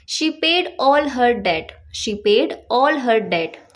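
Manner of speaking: slow, clear example, said twice; slow example sentence. Shown as a demonstration. Teacher reading the example to students, slow and clear. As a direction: read each word slowly for learners. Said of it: "या फिर आपको बोलना हो".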